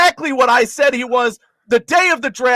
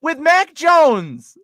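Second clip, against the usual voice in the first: incredulous disbelief; 6 semitones higher, 4 dB louder, much slower than usual. Real, not acted. Reacting to an absurd prediction.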